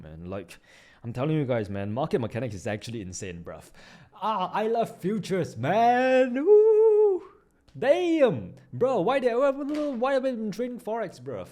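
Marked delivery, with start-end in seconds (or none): 4.2-11.5 much higher, louder joyful cheer; ecstatic whoop. Real, not acted. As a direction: whoop like you just won big. Ecstatic about futures.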